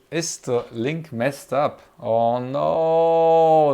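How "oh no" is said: dismayed exclamation. Real, not acted. Link in stream is broken.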